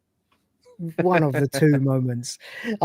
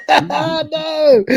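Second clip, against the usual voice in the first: mock horrified cry; 10 semitones higher than usual. Real, not acted. Reviewer reacting to Rimmer's return with a high cry of horror. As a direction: recoil in shrill dismay.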